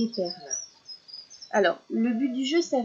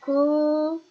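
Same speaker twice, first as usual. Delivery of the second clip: "Cochon" with "co" held long and high; high sustained "co", long pause, "chon"; split into syllables; 6 semitones higher, 4 dB louder than usual. Shown as a demonstration. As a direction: pull "co" and "chon" far apart. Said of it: "Ça fait deux".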